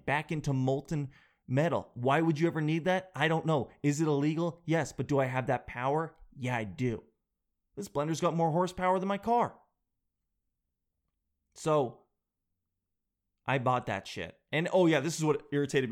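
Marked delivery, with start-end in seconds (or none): none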